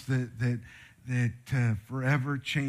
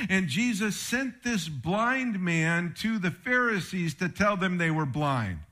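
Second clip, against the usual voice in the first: normal talking voice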